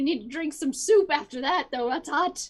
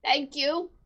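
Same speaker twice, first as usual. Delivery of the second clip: ordinary delivery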